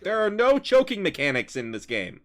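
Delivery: high, loud mock protest; hammed-up indignant shout; yelling out. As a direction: yell it out at the monks. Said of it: "just yelling out at the monks".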